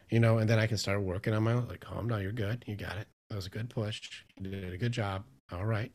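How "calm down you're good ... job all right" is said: reassuring self-talk. Retold or imitated. Speaker coaching himself through panic, softly reassuring. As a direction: talk yourself down gently. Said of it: "reassuring and calm it down".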